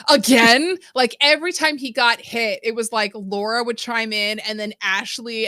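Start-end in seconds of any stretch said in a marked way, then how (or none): 0.1-0.8 exasperated cry. Retold or imitated. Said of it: "then they go".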